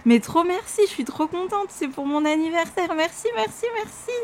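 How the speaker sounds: high-pitched, delighted gratitude; overjoyed thanks. Real, not acted. Thanking sender of birthday gift.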